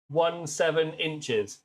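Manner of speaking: ordinary delivery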